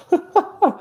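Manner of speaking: high burst of laughter; amused laugh. Real, not acted. Commentator amused by a mad game, laughing. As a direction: laugh at the madness of it.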